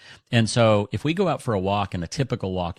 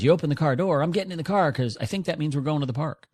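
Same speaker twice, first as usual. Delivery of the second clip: higher-pitched dog's inner voice, lightly acted; voicing the dog; 7 semitones higher, faster than usual. Dog explaining why he jumps in, higher voice. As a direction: speak as the eager dog, pitched up. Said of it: "He goes".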